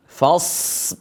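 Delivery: "Fas" with open a and long hissed s; flowing sad. Shown as a demonstration. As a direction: let the s of "Fas" run on. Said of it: "Perhatikan sekali lagi".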